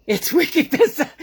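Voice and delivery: loud, high Boston accent showing off the slang. Shown as a demonstration. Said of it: "as they say here in Boston".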